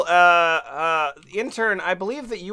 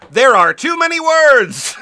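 loud, exasperated outburst; loud exclamation; 6 semitones higher, 9 dB louder than usual. Real, not acted. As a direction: throw the line out loud and fed up. Exasperated joke about wiki.

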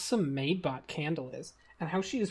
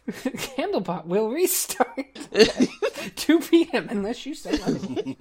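laughing while reading; 6 semitones higher, 8 dB louder than usual. Real, not acted. Reading bot message, laughing.

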